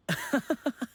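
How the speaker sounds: high, light affectionate laugh; fond chuckle. Acted. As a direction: laugh at an old friend's quirk. Fondly recalling his fear of heights.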